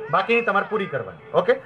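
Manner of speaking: ordinary talk, nothing marked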